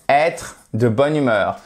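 usual speaking voice

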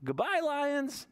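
high, slow waving goodbye; high slow goodbye to the lions; sing-song goodbye. Pretend. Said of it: "and we say".

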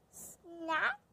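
plain reading voice

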